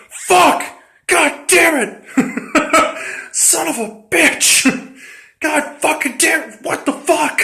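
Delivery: high, cursing rant broken by laughs; a hammed-up tantrum, laughing through the curses; mock furious outburst. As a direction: play the betrayed player swearing while laughing. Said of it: "he's just like".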